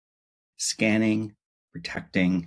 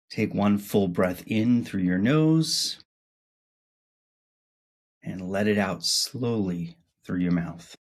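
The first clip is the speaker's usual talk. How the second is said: guided meditation. Acted. Host guiding listeners into breathing before sleep, guided meditation. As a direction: guide them gently through each breath, unhurried.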